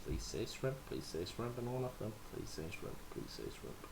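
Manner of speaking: soft chant sinking to a whisper; fading whispered plea. Real, not acted. Pleading with Sean for a shrimp tank, fading to a whisper. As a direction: beg under your breath, fading away.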